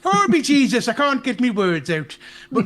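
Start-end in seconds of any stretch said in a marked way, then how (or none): 0.0-0.9 a quick mock Irish exclamation, higher and louder; Irish accent. Joking after talk of Irish accents, a hammed-up Irish exclamation. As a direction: throw out a jokey stage-Irish oath. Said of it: "if I do an Irish accent".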